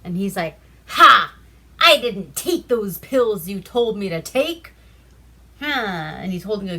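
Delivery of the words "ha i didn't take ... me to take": loud, gloating in a put-on voice; triumphant shout. Mustachio gloats at the doctor he defied, loud triumphant shout. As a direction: crow it in the doctor's face.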